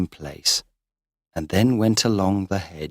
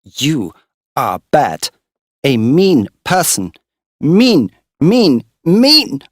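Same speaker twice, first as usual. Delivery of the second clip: loud, high, rising shouts; wildly angry; 8 semitones higher, 7 dB louder, slower than usual. Acted. Girl up the haystack scolding Morris, furious shouts. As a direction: hurl each mean at him. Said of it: "She was wildly angry".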